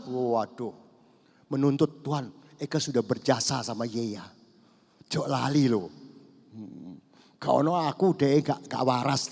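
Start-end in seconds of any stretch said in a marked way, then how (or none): none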